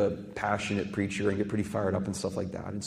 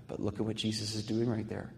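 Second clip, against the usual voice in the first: ordinary talk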